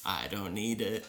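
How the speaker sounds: lightly voiced dried-out cartoon character, low and quiet; a hint of a parched cartoon refusal, softly; SpongeBob. Shriveled SpongeBob refusing water, lightly imitated low voice. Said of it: "SpongeBob's all shriveled up".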